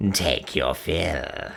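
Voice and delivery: evil cackle then very slow low croak; gloating witch cackling over the treasure. A narrator's reading. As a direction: laugh wickedly, then drag the words. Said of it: "croaked Gagul".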